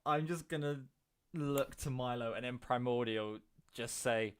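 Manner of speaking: ordinary delivery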